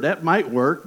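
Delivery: ordinary talk, nothing marked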